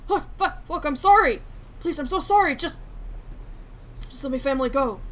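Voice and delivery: frantic, loud apology; terrified plea to spare the family; panicked begging. Acted. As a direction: beg for your family in a panic. Begging after the shooting.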